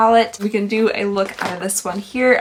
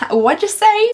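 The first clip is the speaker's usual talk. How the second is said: loud, high, straining to hear; half-deaf "what'd you say"; 10 semitones higher, 6 dB louder, slower than usual. Retold or imitated. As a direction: call out like you can't hear. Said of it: "I have to literally, like, do this".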